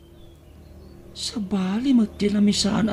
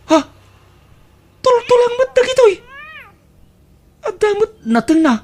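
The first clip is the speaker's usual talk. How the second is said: high, loud disbelief at the bones; shocked discovery; 4 dB louder than usual. Acted. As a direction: squeal it out, much higher, disgusted. Opens leftovers, finds only bones. (nasdaaw a pukkaw)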